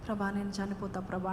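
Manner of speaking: soft, whiny mock moan; grumbling to God in a soft mock whine. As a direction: moan it like a tired complainer. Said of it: "సనుగుతుంటే".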